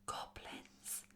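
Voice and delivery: hushed, anxious whisper; nervous whisper. A narrator's reading. Amber warns Kirsty of goblins, whispering nervously. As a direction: whisper it nervously into someone's ear. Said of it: "Amber whispered nervously in Kirsty's ear".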